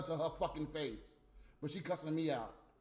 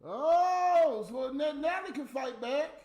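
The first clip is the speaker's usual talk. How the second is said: higher, louder sudden shout of surprise; surprised outburst; 7 semitones higher, 5 dB louder, slower than usual. Real, not acted. Streamer shocked that Natalie fights back, loud surprised shout. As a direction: blurt it out, caught off guard.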